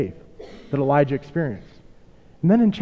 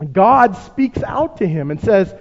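usual speaking voice

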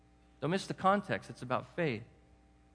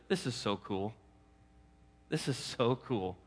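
hushed amazement; 6 semitones lower, 5 dB quieter than usual. Acted. Jesus voiced, amazed and hushed.

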